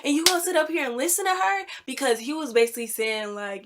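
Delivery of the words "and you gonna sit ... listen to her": high-pitched indignant plea to dad; retold tearful complaint. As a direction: act out upset tattling, without real sobs. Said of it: "I was crying to my dad".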